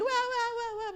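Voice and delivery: high loud barking wahs; imitated dog barking. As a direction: bark like a frantic dog. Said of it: "nagwawala si Obi". (kahol ng nagwawalang aso)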